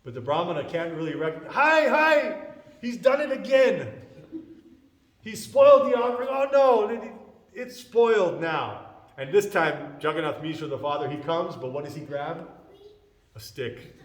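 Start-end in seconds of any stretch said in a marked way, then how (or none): 1.5-8.9 loud, high-pitched wail of dismay; alarmed outcry. Retold or imitated. Brahmana catches Nimai eating the rice, loud dismayed cry. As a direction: cry out as if disaster has struck.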